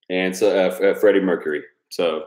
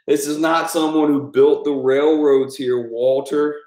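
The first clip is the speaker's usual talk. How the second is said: slow, louder, higher put-on Dude rant; Lebowski quote; 6 semitones higher, 3 dB louder, slower than usual. Quoting the Dude scolding Walter, slow raised put-on voice. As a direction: lecture Walter slowly in a raised voice.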